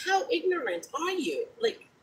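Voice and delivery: hushed, incredulous scolding. Retold or imitated. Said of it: "I was like".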